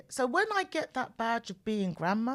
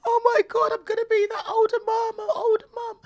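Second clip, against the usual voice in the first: much higher, louder, half crying half laughing; laughing despair; 13 semitones higher, 9 dB louder than usual. Retold or imitated. Wife tells her husband she'll be the older mum, laugh-crying and much higher. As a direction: wail it through laughter and tears. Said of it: "coming home to Cookie, my husband, and saying".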